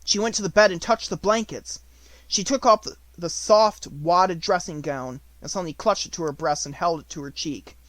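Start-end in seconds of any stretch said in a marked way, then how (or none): none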